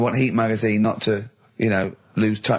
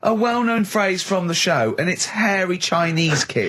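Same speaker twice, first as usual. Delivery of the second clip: mock incredulous; 7 semitones higher than usual. Mocking the quiz premise.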